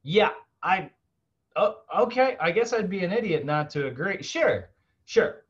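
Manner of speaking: high, mock-hesitant caving in; reluctant yes-man. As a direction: play the pushover who half-heartedly agrees, pitched well up. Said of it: "And I'm just gonna be like".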